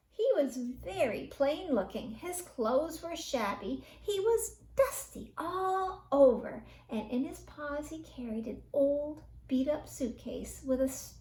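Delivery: ordinary delivery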